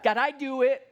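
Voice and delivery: put-on stubborn toddler. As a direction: plead your independence like a three-year-old. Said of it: "what do we say to God?".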